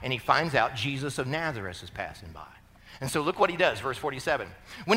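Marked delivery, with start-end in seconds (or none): none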